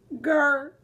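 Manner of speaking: playful tiger growl. Pretend. Confessing she's a tiger, playful growl. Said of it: "I'm a tiger".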